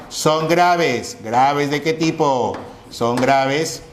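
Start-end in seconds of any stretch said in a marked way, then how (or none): none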